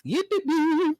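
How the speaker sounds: Pops, giddy and squeaky; Pops impression. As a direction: squeal it like an excited old sitcom dad. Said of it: "That's Pop, bro".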